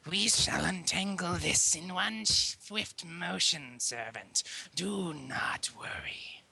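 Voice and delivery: low, slow royal voice; low, stately princess; regal princess. Acted. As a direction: reassure the servant grandly, low and drawn out. Princess answers her servant in story.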